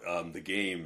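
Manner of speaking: usual speaking voice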